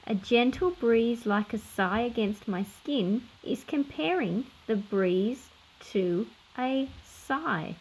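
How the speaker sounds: plain reading voice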